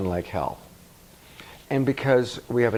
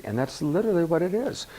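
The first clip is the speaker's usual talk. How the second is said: ordinary talk, nothing marked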